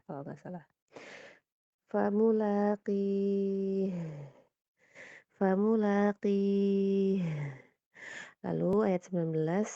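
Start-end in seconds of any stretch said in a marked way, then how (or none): 1.9-7.2 "Famulaqih" very slow, sukun flowing. Shown as a demonstration. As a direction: draw out "Famulaqih" smoothly. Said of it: "kurang ngalir". ("Famulaqih" pelan dan mengalir)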